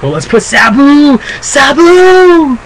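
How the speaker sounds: high, loud drawn-out name call; mock enthusiasm. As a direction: hoot the name as a long, rising cheer. Mimics promoters pushing a replacement.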